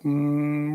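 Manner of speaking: long, low steady hum; imitated engine hum. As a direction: hum like a car crawling along. Imitating the crawling car.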